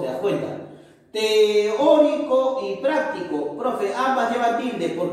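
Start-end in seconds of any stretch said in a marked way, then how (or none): 1.1-3.6 "Teórico y práctico" slowly, leaning on Ó and PRÁC; stressed syllables. Shown as a demonstration. Teacher reading out an example for pupils, slow stressed syllables. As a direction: draw out "Teórico", then hit PRÁC in "práctico". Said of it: "acá está la mayor fuerza de voz".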